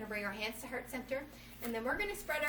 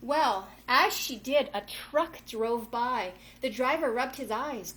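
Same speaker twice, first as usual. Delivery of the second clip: storybook read-aloud, slow and a little louder; story narration; 7 dB louder, slower than usual. A narrator's reading. Yoga teacher reading a picture book to kids, storytelling voice. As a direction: read the rhyming tale to the kids.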